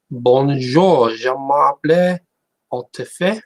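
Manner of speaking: put-on French accent, lifting at the end. Streamer trying out a French self-introduction, in a French accent. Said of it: "I can speak with a French accent".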